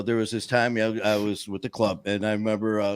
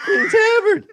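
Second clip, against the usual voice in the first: laughing; 7 dB louder, slower than usual. Real, not acted. Listener cracks up at story.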